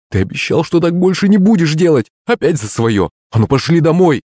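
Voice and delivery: exasperated telling-off, rising in pitch; scolding. A narrator's reading. Woman sends drunken servant home, exasperated scolding. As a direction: scold and order him off. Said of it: "вскрикнула Лариса Батьковна".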